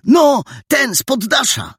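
put-on high, insistent boy's voice; worked-up high exclamation; impatient excitement. A narrator's reading. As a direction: explain it excitedly, as if obvious. Excited boy explains impatiently.

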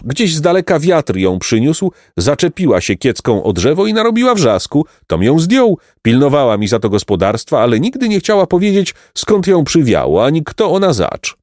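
high, lively farmer telling his tale; chatty peasant. A narrator's reading.

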